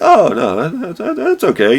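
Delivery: high polite brush-off; high reassuring boss. Retold or imitated. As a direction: squeak out a polite okay. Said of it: "You're like".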